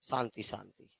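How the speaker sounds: soft, low call for silence; hushing the class. Real, not acted. Teacher to noisy students, quietly hushing them. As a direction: settle the room down gently.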